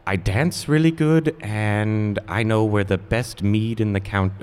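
high, slow goblin voice; bargaining goblin. Acted. Goblin giving reasons to be spared, high slow voice. Goblin pleading for his life.

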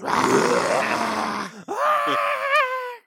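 long, loud, high scream; mock scream. Ponies meeting a creature in the cave, screaming. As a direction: shriek as if a monster jumped out.

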